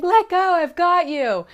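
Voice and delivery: high, loud urging; belayer calling up. Pretend. Belayer reassuring the nervous climber, high loud call. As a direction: shout encouragement up the wall.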